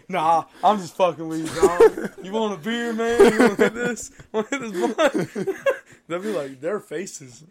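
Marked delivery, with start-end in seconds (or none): 0.1-5.6 high, loud, laughing offer; joking reassurance. Acted. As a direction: drop the fake threat and laugh it off loudly. Threat turns into friendly joking.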